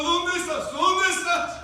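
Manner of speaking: put-on loud, high drunk calling out; drunk shouting. Drunk man searching, shouting loudly for the devil. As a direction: yell it like a drunk hunting for someone.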